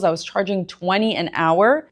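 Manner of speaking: ordinary talk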